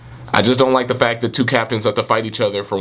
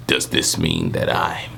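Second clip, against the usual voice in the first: much lower, slow gruff growl; deep Kenpachi voice; 4 dB quieter, slower than usual. Acted. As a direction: drop way down into a heavy growl. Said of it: "You know how Kenpachi talks".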